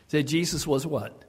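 usual speaking voice

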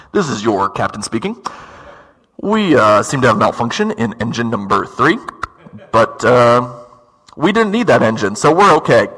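low, casual captain over the PA; airline pilot intercom. Retold or imitated. Pilot announcing engine trouble to passengers, low intercom voice. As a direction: imitate a pilot's unbothered drone into a close, loud microphone. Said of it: "they all sound exactly the same".

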